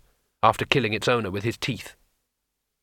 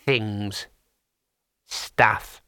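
cautious imp; 2 semitones lower than usual. A narrator's reading.

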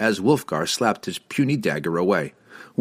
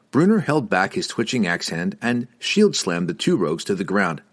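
usual speaking voice

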